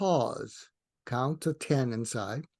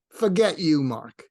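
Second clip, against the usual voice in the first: retold angry dismissal; 7 dB louder than usual. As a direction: imitate an angry woman telling him off. Quoting woman's hostile reply.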